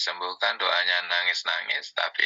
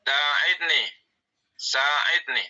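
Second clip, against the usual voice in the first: called command; 7 semitones higher, much slower than usual. Shown as a demonstration. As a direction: sing out Sa'idni like an order. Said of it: "yang mengandung amru".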